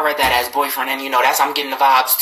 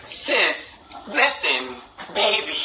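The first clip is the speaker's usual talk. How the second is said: ordinary talk, nothing marked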